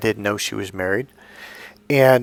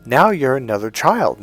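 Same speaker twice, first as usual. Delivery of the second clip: ordinary talk, nothing marked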